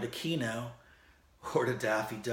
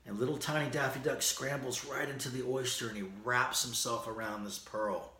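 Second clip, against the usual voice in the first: usual speaking voice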